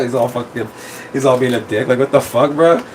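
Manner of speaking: normal talking voice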